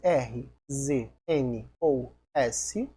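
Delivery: slow, "N ou S" letter by letter; slow dictation. Shown as a demonstration. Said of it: "Então sempre que terminar com". (ditando devagar "N ou S")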